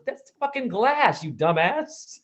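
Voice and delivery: a mock scolding mama; blunt mother. Mother telling Jay it's just glass, slow and exasperated.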